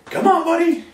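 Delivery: high, loud sing-song invite; a visitor luring the dog up; high coaxing call. Retold or imitated. Visitor at the door calling dog, high loud coax. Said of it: "somebody comes over your house and goes".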